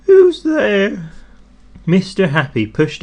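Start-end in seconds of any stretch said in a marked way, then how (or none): 0.0-1.1 high, loud, squeaky little voice; much higher squeaky cry of a sad man. A narrator's reading. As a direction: squeak it out, forlorn. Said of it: "a sad, squeaky sort of a voice".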